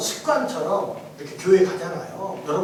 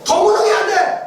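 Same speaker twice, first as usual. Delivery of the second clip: commanding shout; 9 semitones higher, 6 dB louder than usual. Retold or imitated. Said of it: "하나님 안에서 말씀하세요".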